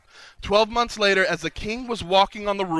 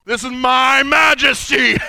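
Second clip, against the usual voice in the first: booming man voice; 4 semitones higher, 7 dB louder, slower than usual. Shown as a demonstration. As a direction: boom "majesty" like a mighty king. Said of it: "they got a man voice".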